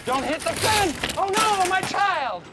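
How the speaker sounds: high-pitched cries of alarm; mock parental panic. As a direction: cry out for an imperilled child, hammed-up. Play-acting panic over stroller test.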